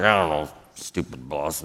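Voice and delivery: low, soft grumble to oneself; muttering. Shown as a demonstration. Employee walking away from the boss, muttering. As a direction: mumble it under your breath, deep and indistinct. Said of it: "when they're muttering".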